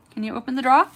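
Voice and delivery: put-on Southern accent. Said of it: "'cause I would say".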